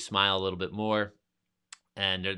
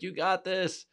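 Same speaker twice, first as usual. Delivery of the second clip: cheering on; 11 semitones higher, slower than usual. Retold or imitated. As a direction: cheer your buddy up the last steps. Brian cheering from the top.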